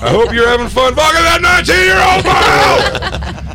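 high, slow, shouted wrestler rant; high-pitched yell. Retold or imitated. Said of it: "He's like".

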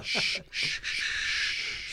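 soft repeated shushing; slow, whispered killer's shushes; mock soothing shush. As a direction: hush your victim softly. Acts hushing a stabbed victim.

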